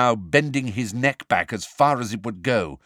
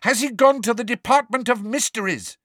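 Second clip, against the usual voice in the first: higher and louder call; urgent call; 13 semitones higher, 4 dB louder than usual. A narrator's reading. Harry calls after the elf, urgent shout. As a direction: call after him desperately.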